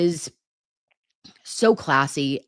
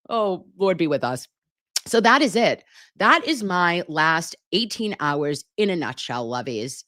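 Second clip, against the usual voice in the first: normal talking voice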